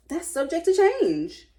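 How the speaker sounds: insistent pitch climb on the last words; rising emphasis. Real, not acted. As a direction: stretch it out, climbing with indignation. Rising indignation.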